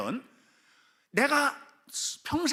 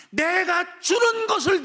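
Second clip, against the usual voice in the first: forceful divine appeal; 11 semitones higher, 7 dB louder, slower than usual. Acted. God's appeal, acted by preacher.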